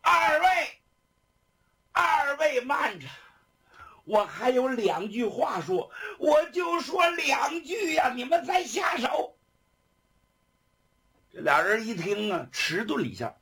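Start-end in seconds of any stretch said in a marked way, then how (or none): 0.0-9.4 urgent, high-pitched plea; crying out. A narrator's reading. Condemned prisoner begs two guards for last words, crying out. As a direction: call out desperately to stall them. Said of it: "这犯人就喊开了".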